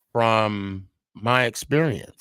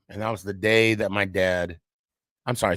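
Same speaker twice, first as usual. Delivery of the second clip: normal talking voice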